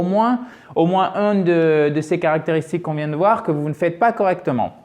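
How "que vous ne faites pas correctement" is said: ordinary talk, nothing marked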